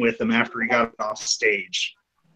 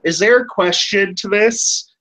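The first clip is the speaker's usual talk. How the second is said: slow, higher-pitched impatient question; impatient guest; 8 semitones higher than usual. Retold or imitated. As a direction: cut in pointedly on a rambler. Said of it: "he's just like".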